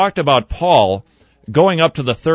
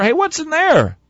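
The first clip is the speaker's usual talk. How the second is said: much higher, eager question; curious newcomer to heaven; 13 semitones higher than usual. Retold or imitated. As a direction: ask Peter like a curious new arrival. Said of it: "a man went to heaven and he said to Peter".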